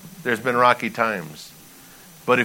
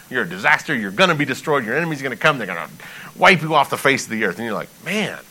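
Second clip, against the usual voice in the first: ordinary talk, nothing marked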